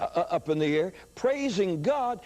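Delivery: ordinary talk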